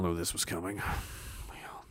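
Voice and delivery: whisper. Real, not acted. Whispered punchline aside.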